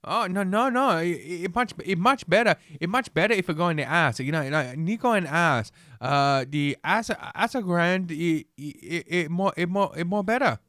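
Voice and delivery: put-on high, stammering caricature of a Chinese accent; hammed-up Chinese-accented broken English; mock Chinese accent. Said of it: "some perverted Chinese guy. He's like".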